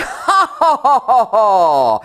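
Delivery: loud, high ha-ha laugh; rollicking mock laughter; hearty laughter. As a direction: burst out laughing like the happy crowd. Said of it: "somewhere men are laughing".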